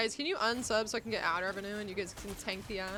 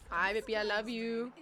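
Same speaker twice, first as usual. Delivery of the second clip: ordinary talk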